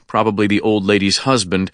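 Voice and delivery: ordinary delivery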